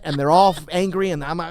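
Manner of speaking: ordinary talk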